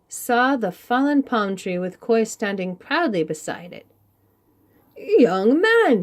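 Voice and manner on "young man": loud, higher call of a commanding chief; chief's address. Acted. Chief calling out to Koi, commanding and loud. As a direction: hail the young man with authority. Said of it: "he said".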